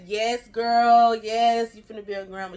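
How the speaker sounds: shouting the good news to mom; excited yes. Retold or imitated. She confirms the pregnancy excitedly.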